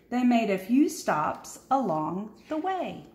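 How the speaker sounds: usual speaking voice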